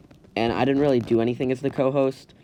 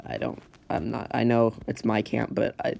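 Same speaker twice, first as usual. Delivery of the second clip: ordinary delivery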